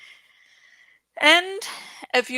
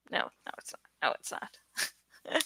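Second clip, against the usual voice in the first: hushed whisper, answering herself; whispered denial; 7 semitones lower, 12 dB quieter than usual. Acted. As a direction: whisper it as a self-mocking aside. Answering her own question.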